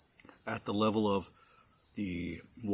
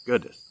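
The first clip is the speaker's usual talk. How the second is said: loud, raspy coughing; coughing fit; 4 semitones higher, 10 dB louder than usual. Real, not acted. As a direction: cough hard through burning throat. Coughing from the chili burn.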